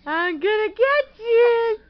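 loud, playful chase voice. Acted. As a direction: boom it out playfully. Parent playing chase with baby.

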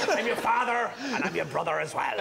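put-on Darth Vader in a West Country accent; rustic West Country Vader, laughing through it. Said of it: "With his West Country accent".

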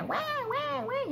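very high, insistent newborn wails; mock infant wailing in quick bursts; imitated strong baby cry. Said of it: "la intensidad así bien fuerte".

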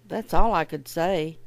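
usual speaking voice

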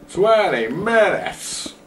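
"Twenty minutes!" stretched slow, high and loud; announcer hype on "Twenty minutes!"; X Factor announcer voice. Shown as a demonstration. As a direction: boom "Twenty minutes!" like a TV talent show host. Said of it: "That was my X Factor voice".

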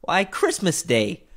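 put-on high, slow child's voice; drawn-out, high-pitched boy's answer. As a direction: state the obvious as a young lad. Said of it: "replied the boy".

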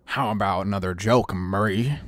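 low, menacing villain question; Heath Ledger's Joker. Acted. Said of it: "try it in the Heath Ledger voice".

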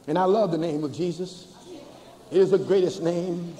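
ordinary delivery

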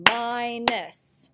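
"Minus" in two drawn-out beats, mi-nus; clapped-out syllables. Shown as a demonstration. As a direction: sound out both syllables of "Minus". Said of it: "We can clap them out".

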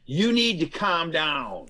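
slow, condescending order; patronizing "calm down"; mock scold. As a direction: talk down to them slowly. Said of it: "because someone says".

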